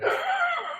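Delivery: high, quiet imitated gasp; sharp pretend intake of breath; mock gasp. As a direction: gasp as if appalled, imitating someone. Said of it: "although Christy'd be like".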